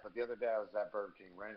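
ordinary delivery

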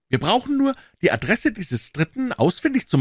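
put-on high, eager young man's voice; Offerton chiming in. A narrator's reading. Offerton interrupting Holmes with a suggestion, high and eager. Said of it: "warf Herr Offerton ein".

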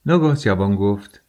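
usual speaking voice

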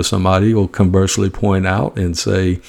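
ordinary delivery

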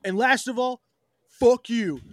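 high-pitched mock tirade, imitating a streamer; mock rant. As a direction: imitate an on-screen rant ending in a punchy insult. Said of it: "he's, like, ranting on screen".

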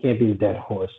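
ordinary talk

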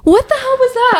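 high startled fright, voice jumping up; genuinely spooked, squeaking it out; scared. Real, not acted. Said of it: "I'm squared".

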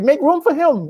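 imitating her high-pitched order; high, telling the family to scoot; high bossy host voice. Host mom ordering her family to make space, high-pitched. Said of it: "And she said".

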